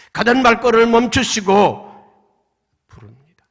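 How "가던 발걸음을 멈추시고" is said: emphatic proclaiming. Acted. Preacher repeats with force.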